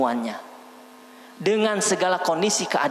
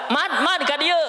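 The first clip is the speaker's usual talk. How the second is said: cheeky student calling; 9 semitones higher than usual. Retold or imitated. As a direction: holler the teacher's name like a pal. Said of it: "dipanggil ya".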